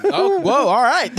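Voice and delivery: very high, loud, taken aback; mock startled reaction. Passenger reacting to the driver's sudden rant, mock startled. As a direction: back off in high-pitched mock alarm.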